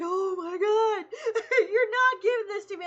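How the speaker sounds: delighted protest through laughter; overwhelmed giggle; laughing disbelief. Retold or imitated. Retold overjoyed reaction.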